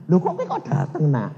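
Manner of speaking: put-on high startled old mother; mother waking, surprised son came. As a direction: wake up amazed.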